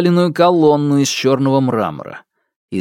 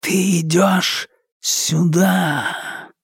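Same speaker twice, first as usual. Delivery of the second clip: slow, higher hissing darkness; 5 semitones higher, much slower than usual. Acted. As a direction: hiss it slowly, luring him in. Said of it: "прошипела тьма".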